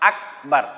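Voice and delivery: plain careful আকবার, k then b; correct Akbar. Shown as a demonstration. Teacher settles on the right আকবার after wrong forms. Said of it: "Alif কাফ জবর আক".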